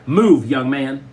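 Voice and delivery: loud, stern mother's order; sharp command. A narrator's reading. Mother cuts off her stalling son, loud and stern. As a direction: cut in loudly, allowing no argument.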